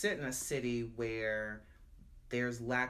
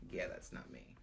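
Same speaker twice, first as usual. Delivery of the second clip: whispered self-doubt; 8 dB quieter than usual. Retold or imitated. Retold self-talk trailing off.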